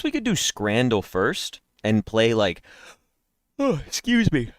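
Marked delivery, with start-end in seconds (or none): 3.1-4.5 sharp high gasp, then excuse me; real startled gasp. Real, not acted. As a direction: caught by a sudden yawn. Sudden yawn or gasp mid-sentence.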